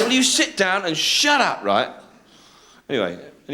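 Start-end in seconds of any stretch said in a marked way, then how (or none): 0.0-1.9 slow, high-pitched order; acted exasperated command; stern telling-off. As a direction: put them in their place, drawn out.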